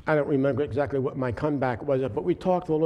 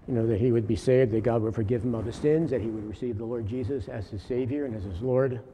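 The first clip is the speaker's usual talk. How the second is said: usual speaking voice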